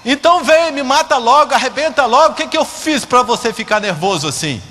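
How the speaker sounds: hammed-up furious challenge to the heavens; angry shouting. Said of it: "ouvia alguém gritando assim". (gritando com raiva, imitando alguém)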